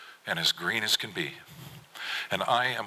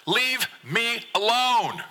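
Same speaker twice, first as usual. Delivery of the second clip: very high, drawn-out cry; angry yell; 3 dB louder, much slower than usual. A narrator's reading. Son shouts dad away from bed, angry. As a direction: stretch each word into a high, furious shout.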